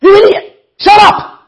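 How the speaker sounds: very high, loud scolding shouts; angry crowd. Acted. Crowd tells the blind beggar to shut up, scolding. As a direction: shout him down in a high, outraged voice. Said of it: "And everybody's like".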